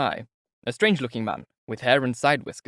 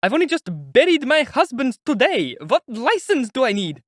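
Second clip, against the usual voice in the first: high, loud, anguished pleading; a new widow crying out at the injustice; distraught widow, much higher and louder; grieving outcry; 12 semitones higher, 6 dB louder than usual. A narrator's reading.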